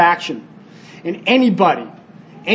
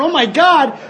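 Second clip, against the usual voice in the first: retold alarm, higher pitch; freaking out; 6 semitones higher than usual. Campers pulling babies from the river, mock freak-out. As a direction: play a mild panic, voice raised in pitch. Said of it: "we're freaking out going".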